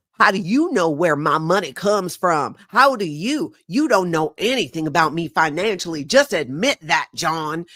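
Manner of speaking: rough Southern accent imitation. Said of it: "I can't go back and forth in these accents".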